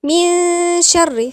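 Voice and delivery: very slow, loud recitation of من شر; hidden-n ghunnah. Shown as a demonstration. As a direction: stretch the ن of من into a nasal hum leading into "sh". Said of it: "في غنة".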